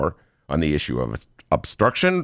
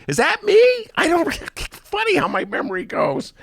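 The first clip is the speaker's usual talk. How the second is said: Daley impression. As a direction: act baffled at your own photo. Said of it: "Stellar Daley impression".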